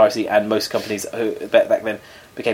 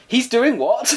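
mock disbelief; 11 semitones higher, much slower than usual. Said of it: "His agent said".

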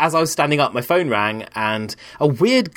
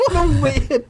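laughing; slower than usual. Real, not acted. Laughing at the memory.

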